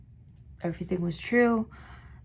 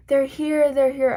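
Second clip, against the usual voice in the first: higher, louder frightened cry; panicked cry; 7 semitones higher, 5 dB louder than usual. Retold or imitated. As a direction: blurt it out in panic, sure they are there. Panicked hallucination retold.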